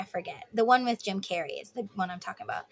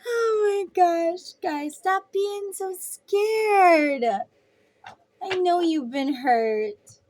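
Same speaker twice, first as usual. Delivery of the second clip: higher and very slow pleading; stretched-out teasing whine, much higher; exasperated whine; 7 semitones higher, much slower than usual. Real, not acted. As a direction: drag it out in playful despair. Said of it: "exasperated plea to viewers".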